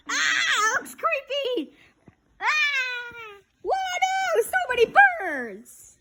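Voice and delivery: high alarmed cries; squealing in fright; frightened squeal. Acted. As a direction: shriek at the swarm of birds. Birds swarming everywhere.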